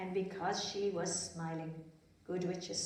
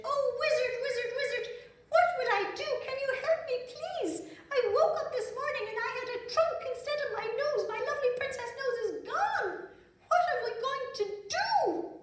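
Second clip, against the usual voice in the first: a high, loud, panicky princess wailing; high, fast, breathless princess pleading for help; 6 dB louder, faster than usual. A narrator's reading. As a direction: squeal it like a frantic little princess. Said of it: "And Prunella said".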